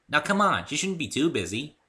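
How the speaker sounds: high, bouncy and eager; high cheerful pony girl; bubbly Pinkie voice. Acted. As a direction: chirp it like an excitable pony. Pinkie's voice again.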